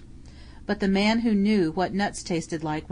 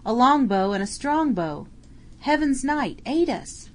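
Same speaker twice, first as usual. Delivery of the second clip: high, raised calls for the saint's help; pleading battle cries; 6 semitones higher than usual. Acted. Medieval bowmen crying to their saint, high pleading shouts. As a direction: cry out to Saint George from the fray.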